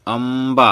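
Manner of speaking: अम्बा with a long, held m; drawn-out pronunciation. Shown as a demonstration. Teacher invites pupils to read along, अम्बा drawn out. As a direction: stretch both syllables of अम्बा. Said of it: "हमारे साथ पढ़िए guys".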